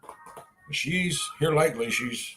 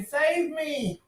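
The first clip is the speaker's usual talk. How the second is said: high playful plea; a high mock cry to be saved; fluttering cries for help; 11 semitones higher, 4 dB quieter than usual.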